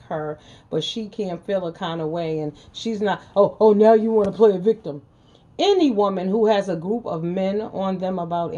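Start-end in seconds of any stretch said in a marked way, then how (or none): 3.4-5.0 high, loud, sneering mock of a man crying victim; sarcastic mock voice of the haters; mocking whiny man. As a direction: throw their whiny line back at them. Mocking the men's complaint.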